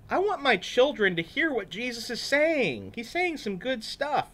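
put-on a mother keen for her kids to hear; a hint of a gushing parent; excited mom. One mom speaks in story.

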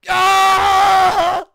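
long, very high, loud scream; rage scream. Real, not acted. Player ticked off by the game, long rage scream. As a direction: scream out all the anger. I've been angrier.

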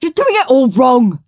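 high, loud know-it-all scold; bossy Henry. A narrator's reading. Big brother Henry scolding Peter, loud and bossy. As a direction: correct him like a smug big brother. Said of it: "said Henry".

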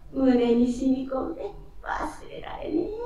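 tearful, breathy whisper; choked whisper. Acted. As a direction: whisper it through tears. Moved thanks, whispered.

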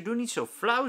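high, loud whining plea; pleading child. A narrator's reading. Lieke pleads with the teacher, high whine. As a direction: beg him not to be mean.

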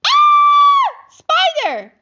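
piercing high shriek of fright; terrified scream. Acted. Family member shrieks on finding the spider.

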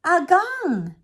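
high, loud call. Acted. Girl calling out to her grandfather, loud. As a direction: call his name across the trees.

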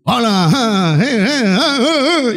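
hammed-up loud greeting with laughter; loud Santander voice. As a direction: play the loud talker with a booming laugh. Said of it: "la gente habla como, dura".

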